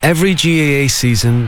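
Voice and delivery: usual speaking voice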